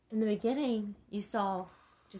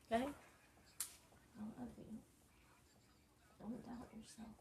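very soft, nearly whispered tenderness; hushed reassurance; 4 semitones higher, 12 dB quieter, slower than usual. Real, not acted. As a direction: say it slowly and barely aloud, from the heart. Tearful mother reassuring her child.

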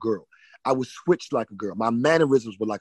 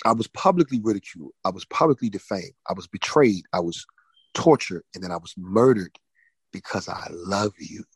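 low, measured, solemn testimony softening to a hush; God's voice; 6 semitones lower than usual. Retold or imitated. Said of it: "And he said".

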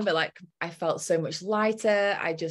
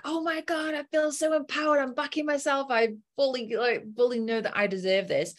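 high, elated gushing; bubbling over with empowerment; giddy and high, floating on cloud nine; 7 semitones higher than usual. Retold or imitated. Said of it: "on cloud nine".

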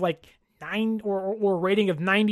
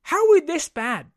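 mock panicking fan; 3 semitones higher than usual. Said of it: "it's like".

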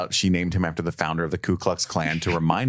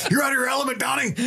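much higher hammed-up Walter, half laughing; mock John Goodman; much faster than usual. Co-host joining the Big Lebowski riff, laughing high parody. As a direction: blurt the line like a cracking parody. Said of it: "John Goodman version".

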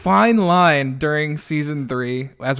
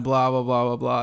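ordinary talk, nothing marked

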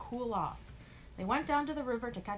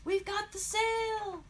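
very high, stretched victory call; triumphant cheer; 13 semitones higher, 4 dB louder, slower than usual. Acted. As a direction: cheer the win, drawing it out. Triumph on getting the sail.

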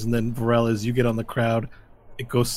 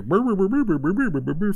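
rolled brr noise; 10 semitones higher, 6 dB louder than usual. Retold or imitated. Shoutcaster crowd noise imitated.

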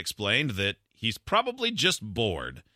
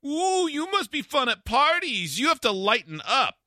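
much higher, slow sing-song taunt; mocking whiny voice; 14 semitones higher than usual. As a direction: tease in a childish sneer. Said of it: "And he said".

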